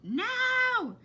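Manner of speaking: very high, very loud shout. Acted. Mother shouting for Flora from downstairs. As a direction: yell her name across the house. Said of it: "shouted Flora's mother".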